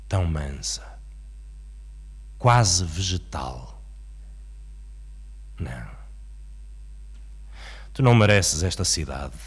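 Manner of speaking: measured, dramatic poetry reading with long pauses; poem recitation. Acted. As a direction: declaim it, swelling from hushed lines to forceful, driven lists. Recites Um Adeus Português.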